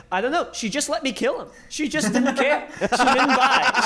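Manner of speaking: high, laughing, slowing at the end; dopey killer clown. Retold or imitated. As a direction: play a giggly clown killer. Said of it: "being like".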